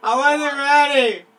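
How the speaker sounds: high, slow, very loud wail of protest; protesting yell. Real, not acted. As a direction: yell it out, dragging the words. Protests losing the round.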